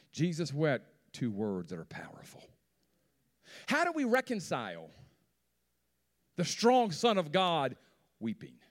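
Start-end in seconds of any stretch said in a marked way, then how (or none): 3.7-8.6 higher and louder, climbing to a shout; preacher rising to a fervent cry; building preaching. Acted. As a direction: build each question bigger than the last.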